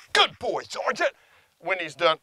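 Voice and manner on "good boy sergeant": high, affectionate praise for the dog; loving reward voice. Shown as a demonstration. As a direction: praise the dog sweetly, pitched up. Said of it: "when I give him the reward marker".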